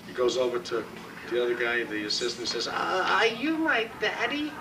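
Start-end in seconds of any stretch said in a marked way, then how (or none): 2.7-4.5 high, slow newborn voice; baby voice. Acted. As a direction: ask it like a tiny baby. Newborn baby asks assistant.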